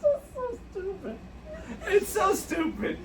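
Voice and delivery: high, soft, laughed-out words; real weak laughter. Real, not acted. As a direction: sigh it out through the tail of a laugh. Worn out from laughing.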